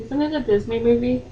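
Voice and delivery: normal talking voice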